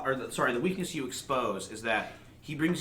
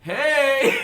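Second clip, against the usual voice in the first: loud, high, cheerful hey; excited greeting; 10 semitones higher, 8 dB louder than usual. Acted. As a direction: greet them like old friends. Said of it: "and he's like".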